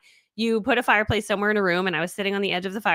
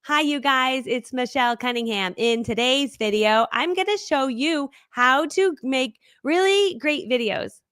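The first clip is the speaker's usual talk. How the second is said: slow, higher-pitched scripted video intro; over-polished, drawn-out presenter delivery; super-curated presenter; 4 semitones higher than usual. Shown as a demonstration. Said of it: "videos that are super curated. Here's an example".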